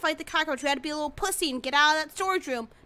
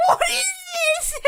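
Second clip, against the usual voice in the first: shocked yell; 5 dB louder, faster than usual. Real, not acted. Shocked reaction.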